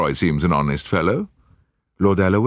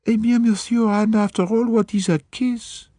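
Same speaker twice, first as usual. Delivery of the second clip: put-on much higher, shrugging French accent; coquettish French maid. French maid to Poirot, saucy French accent. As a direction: make light of it, saucy and French.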